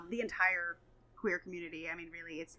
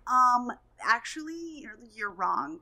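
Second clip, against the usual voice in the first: know-it-all commenter; 3 semitones higher, 7 dB louder, slower than usual. Retold or imitated. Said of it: "they'll just be like".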